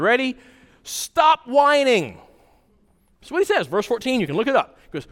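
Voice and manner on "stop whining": slow, high, hammered-out order; stern command. Retold or imitated. Preacher voicing Paul's command to the church, slow and stern. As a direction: lay down the command, word by word. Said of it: "here's your big command".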